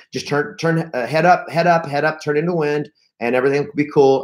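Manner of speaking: ordinary delivery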